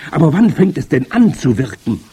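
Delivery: high, loud eager acclaim; excited crowd yell; crying out. A narrator's reading. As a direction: shout adoringly as the witch audience. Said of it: "schrie das Publikum".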